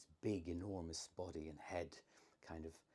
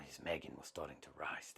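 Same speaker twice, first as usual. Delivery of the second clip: ordinary delivery, nothing marked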